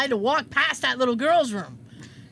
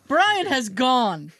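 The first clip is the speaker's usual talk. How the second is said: slow solemn announcement; 4 dB louder, much slower than usual. Retold or imitated. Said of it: "slow dramatic".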